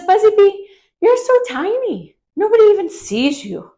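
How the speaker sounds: high, loud taunting character voice; mocking bully. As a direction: tease the little bee in a high, sing-song voice. Bully character taunts Buzzy Bee.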